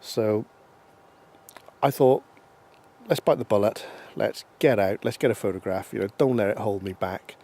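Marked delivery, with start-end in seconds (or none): none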